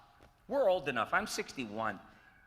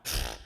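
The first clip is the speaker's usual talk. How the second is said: scoffing pfft, louder burst; dismissive pfft; 11 semitones lower, 8 dB louder than usual. Retold or imitated. Hearing Phil Hartman called, a dismissive pfft. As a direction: scoff it off as a prank. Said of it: "And I went".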